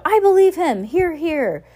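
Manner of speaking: shouted high and loud from the crowd; a pretend crowd shout, high and loud; mock shout. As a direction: call it out like a heckler in a mob. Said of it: "we'll scatter ourselves throughout the crowd and shout".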